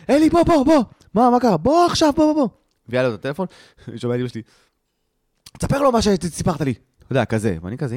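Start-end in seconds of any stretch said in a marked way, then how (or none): 0.1-2.5 high, loud, frantic summoning; imitated shouting mother. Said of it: "צועקת". 5.6-6.7 high-pitched mom giving an order; imitated insistent mother. Mother orders son to tell father.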